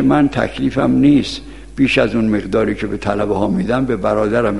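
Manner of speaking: low, soft, composed answer to a mother; calm reply. Retold or imitated. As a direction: imitate a son answering his scolding mother quietly. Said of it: "شیخ انصاری آرام صحبت کرد با مادر".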